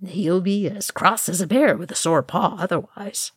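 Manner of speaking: hidden amusement. A narrator's reading. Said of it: "trying and failing to keep the amusement".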